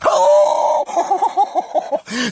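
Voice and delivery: long, loud, high cry of shock; hammed-up shouted gasp; huge gasp. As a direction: gasp as if struck by news. Acted shock at the story.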